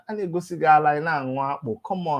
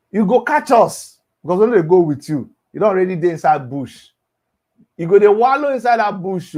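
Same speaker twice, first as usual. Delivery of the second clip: louder, fast warning; 8 dB louder than usual. Real, not acted. Warning the rival, raised and fast. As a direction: push the warning hard.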